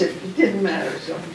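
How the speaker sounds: ordinary talk, nothing marked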